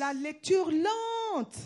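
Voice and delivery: "lente" stretched long; slow right down and draw out "lente"; emphatic stress. Real, not acted. Interpreter relaying the preacher's emphasis, drawn-out "lente". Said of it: "Je veux mettre l'accent sur".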